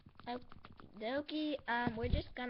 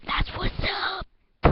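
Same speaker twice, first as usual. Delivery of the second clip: loud; 8 semitones higher, 13 dB louder than usual. Acted. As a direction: lean in and declare it loudly. Same phrase, loud then whispered.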